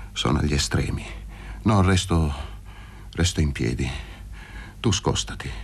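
low, halting, with long pauses; a lightly voiced invalid at the end; dying man. Luca refusing to sit near Paroni, low halting dying voice.

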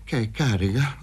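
halting, stuttered question; stammering. A narrator's reading. Paroni faced with the drawn revolver, stammering. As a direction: stumble on the first word, uncertain. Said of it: "balbettò".

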